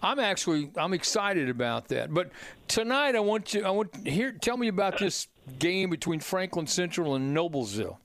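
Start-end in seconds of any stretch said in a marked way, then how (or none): none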